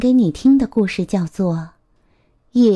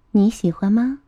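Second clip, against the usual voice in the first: ordinary delivery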